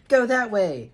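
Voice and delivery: loud, high yelled direction. A narrator's reading. Dan sending the family another way, yelling. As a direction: call out the order forcefully. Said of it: "Dan yelled".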